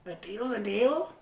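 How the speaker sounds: soft character voice, rising at the end; quiet comedian impression. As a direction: keep the impression small and soft. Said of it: "I'm trying to be quiet, but, like, do his voice".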